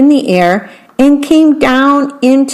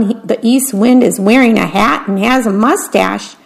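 usual speaking voice

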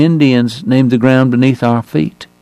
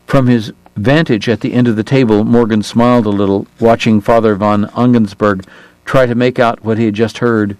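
usual speaking voice

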